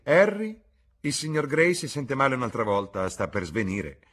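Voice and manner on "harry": much higher and louder, calling his name. A narrator's reading. Duchess calling Harry in alarm, high loud call. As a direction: call Harry urgently across the room.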